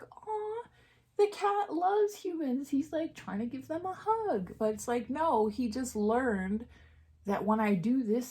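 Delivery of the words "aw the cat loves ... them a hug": much higher, gushing coo. Pretend. Imagined viewer gushing over the hugging cat, cooing. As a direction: coo like you melt at a cute cat. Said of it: "be like".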